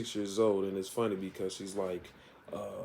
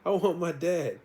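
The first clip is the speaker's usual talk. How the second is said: put-on much higher, bratty child voice; whiny child; 9 semitones higher than usual. Sabrina refusing the cat gift, high whiny kid. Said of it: "Sabrina's like".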